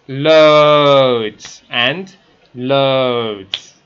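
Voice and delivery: long drawn-out O in both "loads"; "loads and loads" with the vowels stretched right out. Shown as a demonstration. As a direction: draw out each "loads" slowly. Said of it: "you've gotta stretch it".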